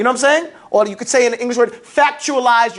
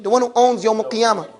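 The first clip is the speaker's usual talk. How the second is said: ordinary delivery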